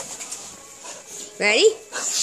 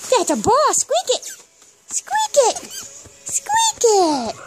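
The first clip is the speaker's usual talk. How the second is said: squeaky pet talk; 9 semitones higher than usual. Real, not acted. Baby talk urging dog to squeak.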